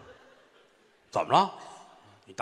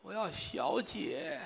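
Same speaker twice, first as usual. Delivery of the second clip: feeble dying voice; 5 semitones higher, 8 dB quieter, much slower than usual. Acted. Dying old man asks feebly.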